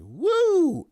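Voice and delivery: high loud woo; excited whoop. Real, not acted. Host whooping at a stock's run, loud. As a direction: whoop with delight.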